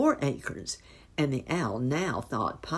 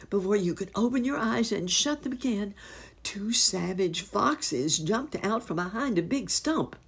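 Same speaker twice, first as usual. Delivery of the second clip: ordinary delivery, nothing marked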